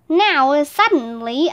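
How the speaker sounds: ordinary delivery, nothing marked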